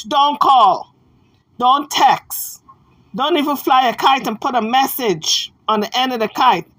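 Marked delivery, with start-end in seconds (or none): 0.0-4.6 emphatic, louder, separate commands; firm warning. Retold or imitated. Spelling out his message to callers, firm warning. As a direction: lay down the rule firmly. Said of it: "That's the message".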